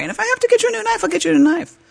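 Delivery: high apology. Retold or imitated. Apologizing to husband on phone.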